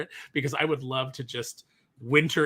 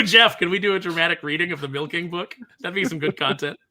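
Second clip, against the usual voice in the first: usual speaking voice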